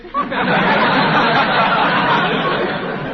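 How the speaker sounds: a big pleased laugh, rising as it goes; hearty chuckle at own joke. Acted. [name] laughing at his own surgery joke, hearty. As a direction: laugh at your own gag, rolling it out.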